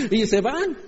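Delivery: high amused laugh; chuckling at the runaway disciples. Real, not acted. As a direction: laugh through the words. Laughs at own joke.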